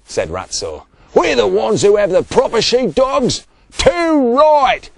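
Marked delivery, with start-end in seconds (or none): 1.1-3.5 loud, high-pitched indignation; furious. A narrator's reading. Ratso complaining to the other shearers, furious. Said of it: "The other shearers were furious". 3.8-4.9 loud, very high, emphatic; indignant agreement. A narrator's reading. Big Bob agreeing with furious Ratso, indignant. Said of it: "agreed Big Bob".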